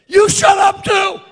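high, loud, very slow rebuke; furious shout. Acted. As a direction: roar it at the storm. Jesus rebukes the wind.

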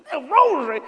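high, loud horrified squeal; mock dismay. Preacher retelling his shock at the priest's penance, high loud dismay. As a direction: gasp it in disbelief.

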